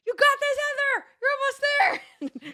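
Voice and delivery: high-pitched yell of encouragement; re-enacted cheering. Racer re-enacting cheers for the girl next to her, shouted. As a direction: cheer them on at the top of your voice, stretching the end.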